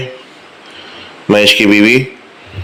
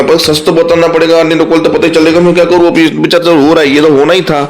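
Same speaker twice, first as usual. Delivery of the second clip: higher, chattering aunt voice; gossipy aunt; 7 semitones higher than usual. Acted. Aunt justifies telling the boy the truth, higher chattering voice.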